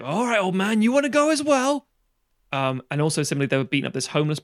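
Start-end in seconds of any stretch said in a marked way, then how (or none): 0.0-1.9 raised, taunting challenge, climbing higher and louder; hammed-up aggressive taunt; mock bully's threat. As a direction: play a thug spoiling for a fight. Acting thug threatening the old man.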